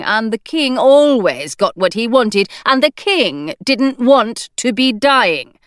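plain reading voice

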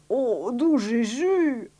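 loud, drawn-out shock; theatrical, stretched astonishment; exclaiming doctor. A narrator's reading. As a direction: cry out slowly in a put-on doctor voice. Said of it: "s'écria le docteur".

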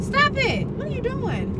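high startled protest; alarmed. Real, not acted. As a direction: cry out at bad driving. Alarmed at driving.

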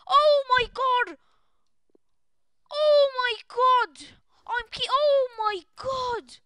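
high, loud, drawn-out exclamation; loud disbelief. Real, not acted. Streamer stunned by his pack pull, loud disbelief. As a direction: cry out in stunned delight.

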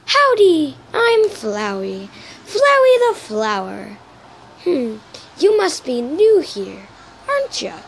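high opening swoops, slow and loud; drawn-out, loud cartoon flower greeting; Flowey impression. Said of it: "time for my Flowey".

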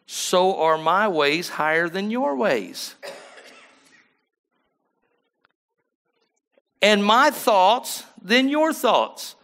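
high, loud, slow proclaiming of the verse; preaching scripture. Acted. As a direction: ring the scripture out to the church.

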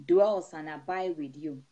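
steady, rhythmic prayer over the flock; prayerful blessing. Acted. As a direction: pray over the congregation with rising fervour. Pastor praying over the congregation.

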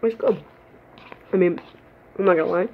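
speaking between bites; talking while chewing. Real, not acted. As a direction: mouth partly full of noodles. Seen 0: Took a noodle bite at -4; lips working, cheeks full while speaking.

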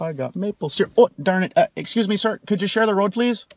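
normal talking voice

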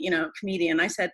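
usual speaking voice

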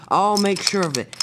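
loud, high villain threat; full-voiced storybook baddie; menacing villain. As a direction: call a threat after fleeing prey. Villain Diesel threatens as he chases.

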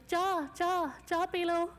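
mild imitated tea hawker's cry, pitched high; street seller's call. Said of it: "And I shouted".

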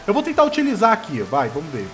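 ordinary talk, nothing marked

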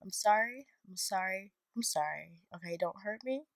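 soft, shrinking sorry; playful quiet apology; mock-timid apology. Real, not acted. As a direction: back down softly, half joking. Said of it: "I'm being really cocky".

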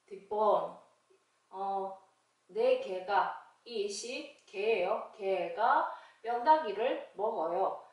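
usual speaking voice

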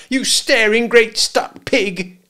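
high, loud, drawn-out scolding; breathless insult. A narrator's reading. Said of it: "out of breath".

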